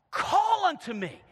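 loud, high, slow "Call unto me"; fervent pleading call; fervent cry. Shown as a demonstration. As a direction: cry it out to God. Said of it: "a word with fervency".

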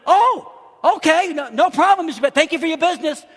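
high, loud, quick recovery; lightly acted pleasant surprise; surprised acceptance. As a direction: imitate a caller caught off guard, then cheery. Caller's surprised cheerful reply.

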